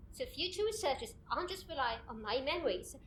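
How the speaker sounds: ordinary talk, nothing marked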